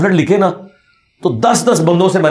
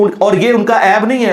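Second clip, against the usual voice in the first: usual speaking voice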